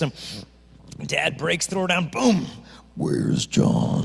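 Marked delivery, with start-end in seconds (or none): 2.9-4.1 much lower, louder dad voice; growled demand from an angry dad. Retold or imitated. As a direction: boom it out like the furious father. Angry dad bursts into bedroom.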